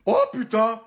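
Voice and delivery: high excited shriek of a starstruck mother. Acted. Starstruck mother shrieking to her son Tom, excited shriek. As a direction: squeal for her son, thrilled.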